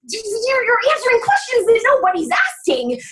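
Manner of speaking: normal talking voice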